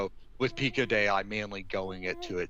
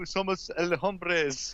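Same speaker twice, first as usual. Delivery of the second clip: meme voice; 10 semitones higher, much slower than usual. Retold or imitated. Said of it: "it should have been".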